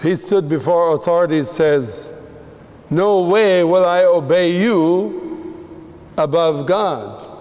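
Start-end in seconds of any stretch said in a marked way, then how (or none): 2.9-7.3 slow, raised-pitch bold refusal; unstoppable defiance. Retold or imitated. Peter refuses the authorities who threatened him, defiant. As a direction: stand up to them, drawing the words out. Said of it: "he was unstoppable".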